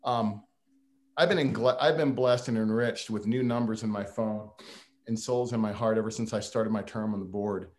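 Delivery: ordinary talk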